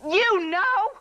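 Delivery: angry retort. Acted. Daughter quarrels with her mother.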